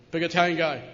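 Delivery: ordinary talk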